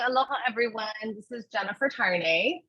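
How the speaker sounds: ordinary talk, nothing marked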